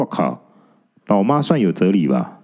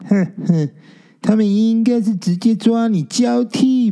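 put-on spooky ghost girl; 12 semitones higher than usual. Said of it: "用大大的衣袖遮着脸说". (用袖子遮脸，高而慢的阴森女鬼声)

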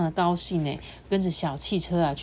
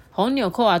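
ordinary delivery, nothing marked

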